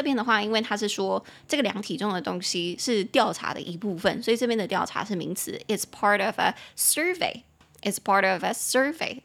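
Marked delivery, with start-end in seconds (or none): 5.7-9.2 "survey" as SUR-vey within the sentence; stress on first syllable. Shown as a demonstration. English teacher repeating the sentence for students, front stress on "survey". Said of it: "所以它重音会在前面哦".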